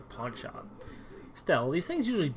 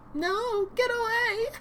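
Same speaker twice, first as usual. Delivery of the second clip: shrieked much higher; panicked shriek; 3 dB louder, much slower than usual. Acted. Terrified captive shrieking at the stranger to get away. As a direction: push him away in panic.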